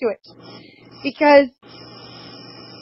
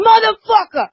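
mock yell, much higher and louder; retold furious yell; 10 semitones higher, 4 dB louder than usual. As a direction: throw out his curse like he did. Husband throwing things, swearing.

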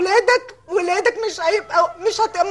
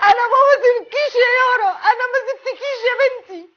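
high, breaking sob of a plea; crying to daughter; 5 semitones higher than usual. Real, not acted. Mother telling daughter Yara she never left her, sobbing. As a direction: weep the promise to her.

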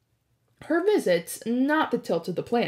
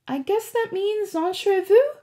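put-on higher, brighter girl's voice; wry higher voice for the girl; Annabeth voice; 11 semitones higher, 4 dB louder than usual.

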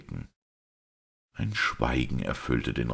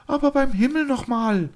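much higher, louder, near-hysterical cry; a duchess losing her composure, crying out in alarm; shrill hysterical outburst, much higher; 5 dB louder than usual. A narrator's reading. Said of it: "beinahe in einem hysterischen Anfalle".

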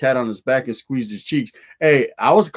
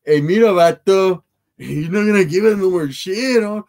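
slow, louder tough-guy voice; lightly voiced tough guy from the show; imitating El Chuy; 5 semitones higher, 4 dB louder than usual. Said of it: "El Chuy says".

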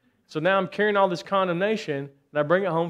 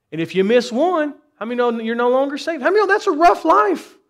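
rising to a high, loud preaching cry; climbing pulpit shout, peaking high and loud; 9 semitones higher, 8 dB louder than usual. Real, not acted. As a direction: build it up and shout the punchline to the congregation.

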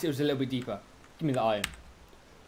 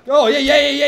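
excited taunt; 11 semitones higher, 15 dB louder than usual. Real, not acted. Taunting fleeing enemies.

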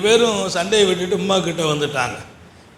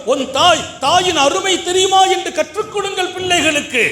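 fiery preaching; 10 semitones higher than usual. Acted. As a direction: thunder the point at the parents.

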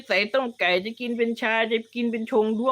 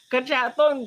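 ordinary talk, nothing marked

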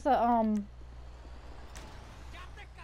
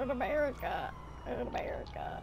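whispering; 3 semitones lower, 12 dB quieter than usual. Pretend. Whispered repeat of joke.

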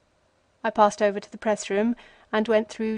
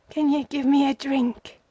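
higher, broken beggar's whimper on "me"; whimpering plea; 4 semitones higher than usual. A narrator's reading. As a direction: beg weakly for a drink, stressing "me". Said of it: "he whimpered".

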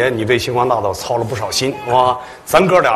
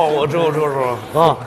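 usual speaking voice

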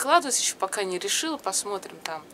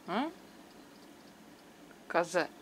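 ordinary delivery

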